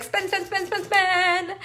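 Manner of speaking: high chanted spend, spend; mock spending frenzy. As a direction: chant it like a giddy shopper. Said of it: "I'd be like".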